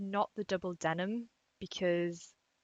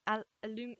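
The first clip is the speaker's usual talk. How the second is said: ordinary delivery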